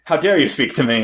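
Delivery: usual speaking voice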